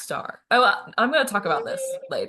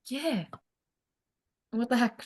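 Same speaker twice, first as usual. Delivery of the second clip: normal talking voice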